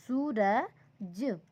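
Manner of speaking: Suraj drawn out very slowly, each sound apart; slow syllable by syllable. Shown as a demonstration. Teacher slowly spelling out Suraj for her students. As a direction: stretch Suraj out slowly for the class. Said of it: "बस हमें यहाँ लिखना है".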